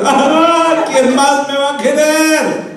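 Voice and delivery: high, drawn-out hammed-up wail; mock crying wail. Woman crying to her boyfriend, hammed-up tearful wail. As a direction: wail melodramatically like a jilted lover. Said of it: "cuando usted le esté llorando".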